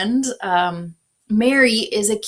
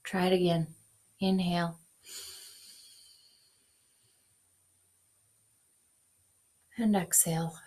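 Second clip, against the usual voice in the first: guided meditation; 3 dB quieter than usual. Acted. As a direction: ease them into breathing. Leads a breath prayer.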